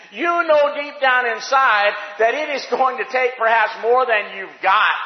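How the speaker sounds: loud, high-pitched preacher's cry; full-force preaching. Real, not acted.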